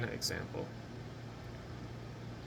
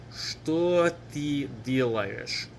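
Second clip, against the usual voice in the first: very slow, stretched-out teaching delivery; slow model pronunciation; much slower than usual. Shown as a demonstration. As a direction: draw each word out for learners. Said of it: "Or faster".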